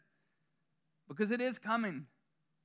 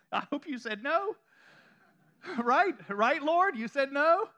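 laughing plea; 5 semitones higher, 4 dB louder than usual. Pretend. Pleading with the Lord, laughing.

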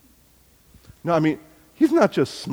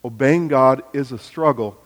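ordinary talk, nothing marked

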